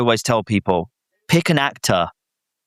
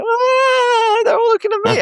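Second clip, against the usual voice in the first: very high, whimpering comic wail; imitated panicked squeal; mock stage panic; 3 dB louder, slower than usual. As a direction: act out stage fright in a squeaky mock cry. Retold stage panic, acted out.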